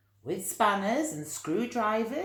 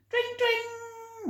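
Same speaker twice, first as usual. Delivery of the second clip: high sing-song dring of a bicycle bell; playful bell sound for children; bell imitation. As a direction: ring it out like a bike bell, much higher. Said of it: "went Missus Armitage on the bell".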